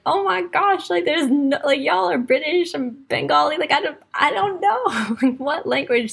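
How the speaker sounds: squeaky, flustered mock panic with a laugh at the end.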